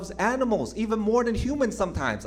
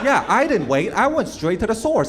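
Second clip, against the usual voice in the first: louder, heated back-and-forth; acted loud quarrel; 8 dB louder than usual. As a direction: argue it out with a cheap pet buyer. Acted argument with pet buyer.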